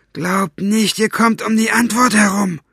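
high, loud villain's warning; threatening. Acted. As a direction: warn captives they will answer. Basta threatens the silent captives.

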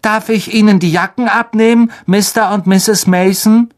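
high, put-on polite boy; forced-smile politeness. A narrator's reading. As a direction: recite it sweetly through a forced smile. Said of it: "setzte ein gezwungenes Lächeln auf".

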